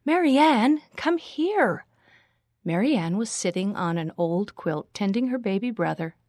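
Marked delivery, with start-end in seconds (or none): 0.0-1.9 high, insistent louder call. A narrator's reading. Rachel calling again to her unresponsive friend, louder. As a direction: call out to a friend who didn't hear. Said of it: "she spoke louder".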